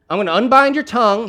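high, loud, emphatic delivery; addressing a deaf man. Acted. Preacher plays Jesus signing to a deaf man, loud and high. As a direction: push the words out loud and high. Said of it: "communicate that to a deaf man".

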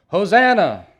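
loud, high cry of "Hosanna!"; crowd's shout. A narrator's reading. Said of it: "those who followed shouted".

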